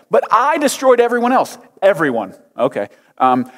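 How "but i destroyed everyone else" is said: high self-justifying whine; whiny Saul. Acted. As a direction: boast like a kid. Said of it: "whiny voice".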